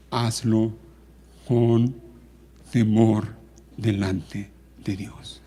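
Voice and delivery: soft, sorrowful admonition; quiet, solemn pulpit appeal; grave, subdued preaching. Real, not acted.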